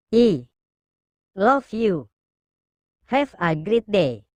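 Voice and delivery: slow and halting, with pauses; drawn-out, tentative reading aloud; slow, hesitant. A narrator's reading. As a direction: say it bit by bit, reluctantly.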